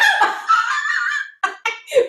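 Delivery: high, whooping laugh. Real, not acted. Host cracks up at the acted thought, high laugh.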